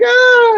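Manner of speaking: high mock scream of the fly man. Retelling The Fly to podcast hosts, high mock scream. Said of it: "and he's like".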